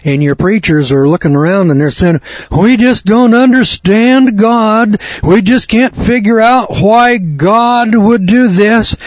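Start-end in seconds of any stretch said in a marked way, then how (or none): 2.5-9.0 much higher, slow, whiny bewildered preacher voice; mock them as helpless, baffled clergy. Sending up preachers who can't explain the hurricane, high whiny mock.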